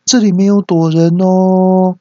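high, loud, long-held last word; loud answering call. Retold or imitated. Hiding son answers classmates at hide-and-seek, mock loud shout. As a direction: sing out an innocent call, slowly. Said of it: "那我都会大声的回答说".

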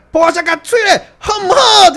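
very high, loud rallying yell; hammed-up hype cry; mock cheer shout. Shown as a demonstration. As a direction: whip the crowd up with a shout. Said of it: "呃，大概这样".

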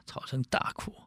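ordinary delivery

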